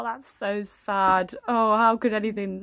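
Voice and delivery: drawn-out mock sadness; fake sympathy, slow. Mother pretending to grieve, mock sad. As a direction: stretch it out insincerely. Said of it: "pretend you care".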